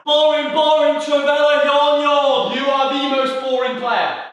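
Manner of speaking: high, loud taunting chant; shouted heckling. Real, not acted. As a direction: jeer at a boring opponent. Heckles opponent mid-game.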